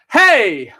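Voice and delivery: loud, high "Hey!" shout; final hey. Shown as a demonstration. Choir leader ends the shake, shouted hey. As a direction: shout it out to finish. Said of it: "then ones, then hey".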